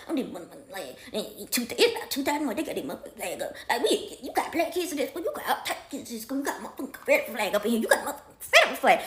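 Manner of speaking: very soft, muttered griping; mother muttering curses to herself; mumbling under breath. Retold or imitated. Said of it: "she mumbling under her breath".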